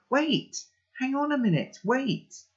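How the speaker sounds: high-pitched shout to stop them; calling out. A narrator's reading. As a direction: call after someone running away. And I shout again.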